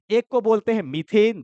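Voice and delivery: normal talking voice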